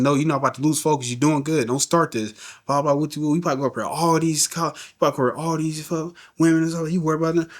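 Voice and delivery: mother warns her son off women and distractions; mom going off. Retold or imitated. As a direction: imitate mom scolding him, half-serious. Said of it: "She's going off".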